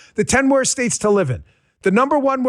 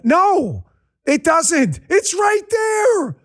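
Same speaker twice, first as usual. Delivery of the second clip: exasperated shout; 6 semitones higher, much slower than usual. Real, not acted. Host exasperated at viewers.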